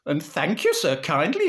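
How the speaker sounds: loud, high sarcastic thanks; hammed-up Northern indignation; blazing mother. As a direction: thank him in mock fury. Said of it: "Mother got proper blazing".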